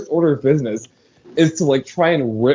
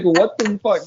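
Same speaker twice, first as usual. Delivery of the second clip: higher-pitched imitated panic; retold panicked reaction, pitched up; mock panic; 6 semitones higher than usual. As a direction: lightly act out sudden bewildered alarm. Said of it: "he starts panicking".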